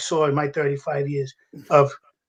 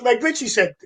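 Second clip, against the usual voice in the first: ordinary delivery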